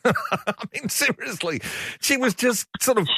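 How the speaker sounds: high, laughing through the words; can't stop chuckling at the story. Real, not acted. Radio host to listeners, laughing at [name]'s cheeky joke.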